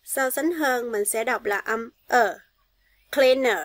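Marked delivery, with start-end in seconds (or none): 3.1-3.6 cleaner with the final er said as a short uh; schwa ending. Shown as a demonstration. Teacher models for pupils: cleaner, final uh. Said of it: "Các bạn để ý những âm này".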